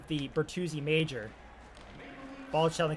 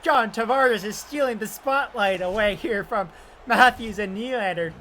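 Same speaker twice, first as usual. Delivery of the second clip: excited goal call; 5 semitones higher, 6 dB louder than usual. Acted.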